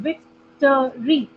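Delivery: Victory slowly, in three separate syllables. Shown as a demonstration. Teacher counting syllables for students, victory split by syllable. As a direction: break victory into three beats. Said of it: "three times बोल रही हूँ मैं words".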